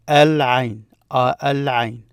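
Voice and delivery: Al-Ain with a clearly sounded L; L pronounced. Shown as a demonstration. Teacher asking learners to notice, Al-Ain with L pronounced. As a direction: keep the L before Ain in Al-Ain. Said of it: "Notice you pronounce the L there".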